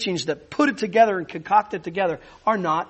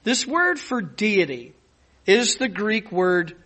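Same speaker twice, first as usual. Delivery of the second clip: normal talking voice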